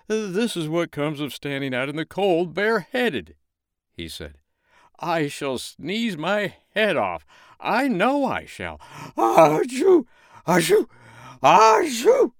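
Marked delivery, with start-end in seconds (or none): 0.1-3.4 high, complaining snowman. Acted. 5.0-8.8 high, slow fretting snowman; worry, slow and high. Acted. 9.2-12.3 high, loud building sneezes; huge sneezes. Acted. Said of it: "sneezing as hard as he could".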